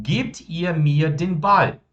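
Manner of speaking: very slow, every German word laid out clearly; slow model sentence. Shown as a demonstration. Teacher modelling German for students, very slow and clear. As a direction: spell the sentence out slowly for the class. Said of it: "Vou formar o meu imperativo".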